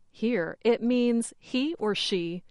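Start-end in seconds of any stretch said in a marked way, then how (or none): none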